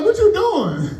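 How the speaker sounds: high surprised question; mock surprise. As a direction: act hurt and surprised. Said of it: "I'm like".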